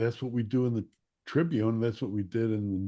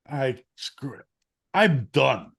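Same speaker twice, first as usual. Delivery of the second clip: put-on a kid's fed-up outburst, last bit louder; fed-up quitting; 3 semitones higher, 5 dB louder, slower than usual. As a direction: throw up your hands, done with it. Kid quitting in frustration.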